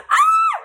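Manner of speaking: high shrieking laugh into a yell; high laughing scream, very loud at the end. Real, not acted. Telling of her dramatic escape.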